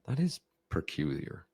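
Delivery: high, puzzled "huh" and curious questions; confused. Shown as a demonstration. Coach matching a confused kid, puzzled and inquisitive. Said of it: "If they're confused, I'm gonna go".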